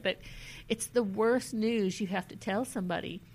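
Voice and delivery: normal talking voice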